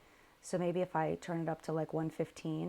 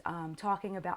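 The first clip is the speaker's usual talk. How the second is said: normal talking voice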